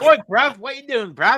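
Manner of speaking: high, loud cockney challenge; mock London accent. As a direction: bark it like a London lad.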